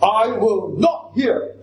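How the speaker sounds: slow, hard stress on NOT; "not" punched in a slow, measured line; emphatic refusal. Shown as a demonstration. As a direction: make "not" final and absolute. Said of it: "That's what this phrase means".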